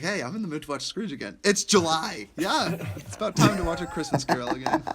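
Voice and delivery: mock excited husband. Imitating brother who wants Scrooge in July. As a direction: tease him with a cheery mock voice. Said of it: "he's like".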